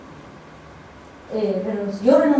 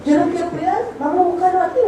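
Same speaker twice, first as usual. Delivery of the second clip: put-on eager little girl; 6 semitones higher than usual. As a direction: lightly voice the daughter pleading. Daughter's words voiced by mother. (niña entusiasmada, un poco más aguda)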